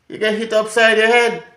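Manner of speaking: high, loud punchy threat; loud warning. Real, not acted. Comic warning the crowd, shouting. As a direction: hit it hard. Warning about Jamaican discipline.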